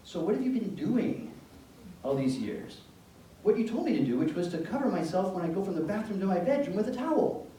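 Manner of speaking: a mother who can't believe it; exasperated mom. Retold or imitated.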